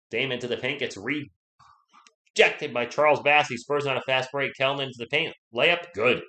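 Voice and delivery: lifted, excited basketball call; energetic courtside commentary, punching the big plays; live play-by-play. Real, not acted. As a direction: call the fast break as it happens.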